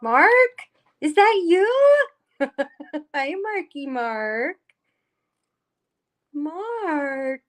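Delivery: high, delighted "Is that you?"; surprised greeting. Real, not acted. Greeting Mark as he joins, happily surprised.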